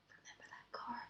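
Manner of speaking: true unvoiced whisper, barely audible. Real, not acted. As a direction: whisper it so only your friend hears. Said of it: "I should do a stage whisper so they can hear".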